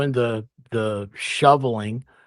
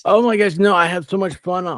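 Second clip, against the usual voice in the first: loud, high burst of delight; excited; 7 semitones higher, 6 dB louder than usual. Real, not acted. Guest gushing to the host about fun nights, loud and high. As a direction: gush it out, much higher and louder.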